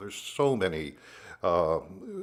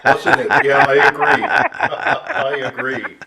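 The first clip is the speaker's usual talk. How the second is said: laughing; 6 semitones higher, 12 dB louder, slower than usual. Real, not acted. Laughing agreement at joke.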